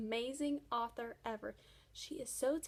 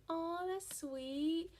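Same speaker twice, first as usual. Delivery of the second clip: mild aww; 4 semitones higher, much slower than usual. Retold or imitated. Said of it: "like, emotion going".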